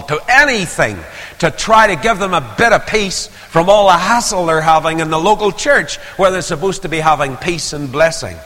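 preaching at full force. Acted. Preacher rebuking Christians who fled the church, fiery. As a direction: drive it home from the pulpit.

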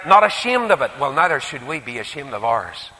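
ordinary talk

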